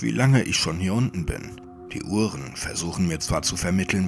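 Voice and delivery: ordinary delivery, nothing marked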